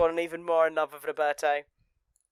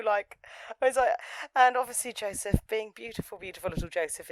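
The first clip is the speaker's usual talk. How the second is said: ordinary talk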